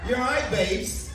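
a light put-on English "you're all right, babes"; English greeting. Cruise director imitating a British greeting to the crowd, lightly accented. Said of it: "normally we would say in England".

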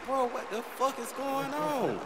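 high, slow shocked question; shocked disbelief. Retold or imitated. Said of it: "I'm like".

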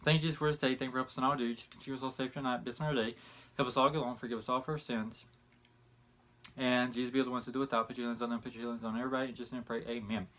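soft, hushed mealtime grace; quiet prayer. Real, not acted. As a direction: pray quietly before the meal. Says grace before eating.

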